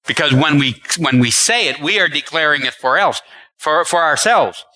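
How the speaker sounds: normal talking voice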